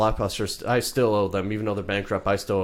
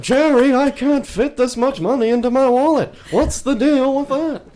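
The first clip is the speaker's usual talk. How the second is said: much higher, louder comic sitcom voice; squeaky over-the-top sitcom character; sitcom impression; 8 dB louder than usual. Seinfeld joke, sitcom impression.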